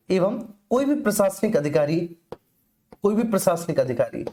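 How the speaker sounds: ordinary delivery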